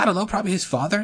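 high, fast imitation of a flustered mother; quick high mock mother; other mom. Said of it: "She's like".

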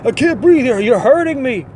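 high, whiny fake-hurt complaining; hammed-up suspect faking pain; mock pained whine. Suspect whining to arresting cop, hammed-up pained whine. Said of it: "he says things like".

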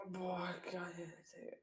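breathy awed whisper; Flüstern. Real, not acted. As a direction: whisper it in amazement. Excited about a reclining chair. (staunend geflüstert)

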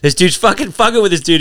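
ordinary talk, nothing marked

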